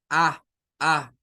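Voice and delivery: A said as an open Spanish A; plain open Spanish A vowel. Shown as a demonstration. Teacher shows the Spanish A students use. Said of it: "Y solo decimos esa A".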